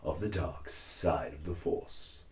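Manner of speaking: low, slow, soft menacing Vader; slow and in control, like Vader; a cosplayer sliding into his Vader voice; Darth Vader voice. Shown as a demonstration. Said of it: "I forgot how to sound like, like Vader".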